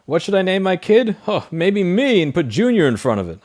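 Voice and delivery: high, loud mock voice of a clueless parent; mock lazy parent. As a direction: ham up a lazy dad picking a name. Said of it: "one of those people that just is like".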